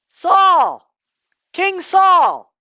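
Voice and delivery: high, loud call across the valley. Acted. David calling down to sleeping Saul, loud. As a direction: shout to him from the hill. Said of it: "David says".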